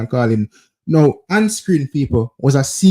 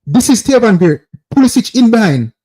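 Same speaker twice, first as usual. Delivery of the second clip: ordinary talk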